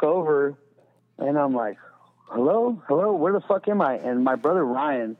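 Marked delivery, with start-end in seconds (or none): 2.3-3.9 confused waking. Retold or imitated.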